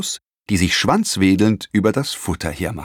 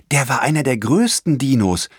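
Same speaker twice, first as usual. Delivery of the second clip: put-on eager boy; 6 semitones higher than usual. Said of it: "sagte Leon".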